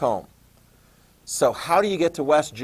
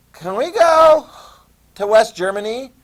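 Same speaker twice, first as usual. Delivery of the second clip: high, loud pleading question; pleading crowd; 9 semitones higher, 8 dB louder than usual. Retold or imitated. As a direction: beg like a crowd at the gate. Crowd of East Germans voiced pleading.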